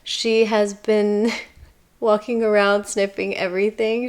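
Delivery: ordinary talk, nothing marked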